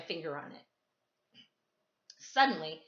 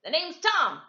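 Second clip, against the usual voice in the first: put-on loud, high eager boy calling out; gawky boy calling out loud; yelling boy; 6 semitones higher, 4 dB louder than usual. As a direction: shout it across the classroom, keen and gawky. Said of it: "yelled out".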